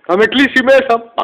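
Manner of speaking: high, loud frightened whine; mock-scared complaint; panicked whine. Scared kid telling his mum he'll be locked up, in a high loud whine.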